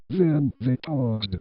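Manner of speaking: ordinary delivery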